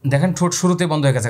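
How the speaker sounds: ordinary delivery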